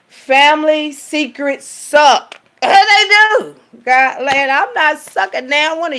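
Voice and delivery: high, loud, slow exclamation; high loud emphatic cry; loud outburst. Real, not acted. Outburst about family secrets.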